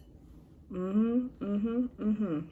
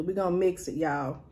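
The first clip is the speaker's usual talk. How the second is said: softer, low, to herself; quiet aside; 4 semitones lower, 7 dB quieter than usual. Real, not acted. Telling viewers the plan, quietly. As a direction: murmur the plan.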